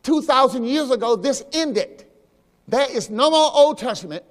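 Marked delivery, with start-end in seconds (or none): none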